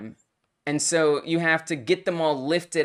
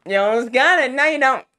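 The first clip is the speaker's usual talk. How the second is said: higher, louder mocking taunt; exaggerated nyah-nyah jeer, pitched up; 7 semitones higher, 6 dB louder, faster than usual. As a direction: tease with rising intensity. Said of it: "taunting me".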